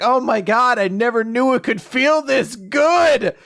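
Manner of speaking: loud, high, overjoyed cry; mock ecstatic exclamation, pitch held high; crying out in pleasure. Massage customer to the masseur, crying out in bliss. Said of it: "you know, crying out".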